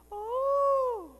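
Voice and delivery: put-on swooning girls. Preacher mimics swooning girls at rom-coms, squealing. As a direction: mimic the girls melting over the romance.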